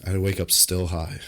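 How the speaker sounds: ordinary talk, nothing marked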